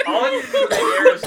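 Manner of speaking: loud, hammed-up stand-up comic voice; badly faked comedian impression, projected; Jerry Seinfeld. Shown as a demonstration. Podcaster making a co-host laugh, loud C-minus comedian impression. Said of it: "my C minus Jerry Seinfeld impression".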